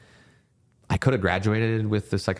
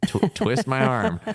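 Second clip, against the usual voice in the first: laughing, slow and higher, playfully sarcastic; mock reluctance; 6 semitones higher, slower than usual. Joking that she had to be forced to France, laughing and slow. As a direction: pretend to be forced, laughing through it.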